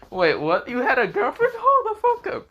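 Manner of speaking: loud, climbing to a very high squawk; shocked disbelief. Acted. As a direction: react in hammed-up astonishment, laugh in the voice. Acted shock at friend's news.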